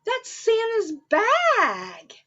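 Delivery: high, slow excited cry; excited reveal. Acted. Showing the children Santa's bag, excited. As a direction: reveal it with wonder.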